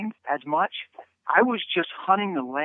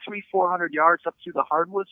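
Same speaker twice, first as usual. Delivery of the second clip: ordinary delivery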